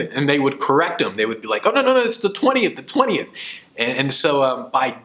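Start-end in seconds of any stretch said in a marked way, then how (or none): 1.7-3.3 high, fast caller rushing to fix the date; eager correction. Retold or imitated. Clients rush to correct the speaker, high and fast. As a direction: hurry to set the date straight.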